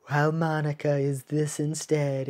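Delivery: quiet, level put-on voice; Monica. Shown as a demonstration. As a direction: keep it even and monotone. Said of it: "a little bit more monotone".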